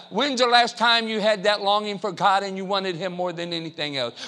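ordinary talk, nothing marked

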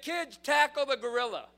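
loud, high-pitched battle cry; rallying shout. Retold or imitated. Sunday school leader to kids, rallying yell. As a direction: holler a command across chaos.

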